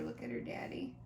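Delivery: plain reading voice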